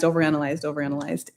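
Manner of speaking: softly fading last repetition; fading to near silence. Real, not acted. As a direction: let the repeated overanalyzed die away into nothing. Repeating the word, letting it fade.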